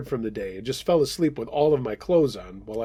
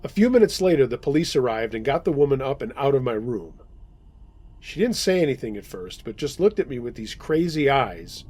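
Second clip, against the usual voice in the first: ordinary delivery, nothing marked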